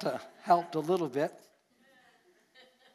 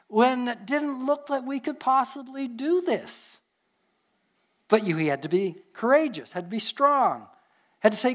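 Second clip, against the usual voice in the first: rising preaching; 7 semitones higher than usual. Acted. Preaching climax on stepping out in faith.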